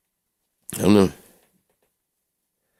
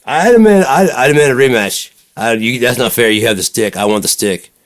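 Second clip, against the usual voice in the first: high, loud hammed-up demand for a rematch; playful fake protest, loud and high; mock outrage; 7 semitones higher, 8 dB louder than usual. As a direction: whine it like a sore loser playing along.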